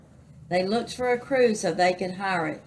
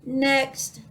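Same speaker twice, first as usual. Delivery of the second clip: higher parrot call, mildly blared; blaring parrot; 6 semitones higher than usual. A narrator's reading. Parrot summons the last rascal, blaring. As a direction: call out like an impatient bird. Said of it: "blared the parrot".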